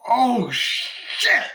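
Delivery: high, loud stunned "oh shit"; shocked gasp. Retold or imitated. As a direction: react like the viewer just realised. Viewer's shocked reaction.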